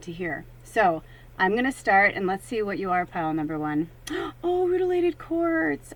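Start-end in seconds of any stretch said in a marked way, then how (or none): 4.1-5.9 high, slow delighted coo. Real, not acted. Drawing a favourite stone, high slow delight. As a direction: savour the name with joy.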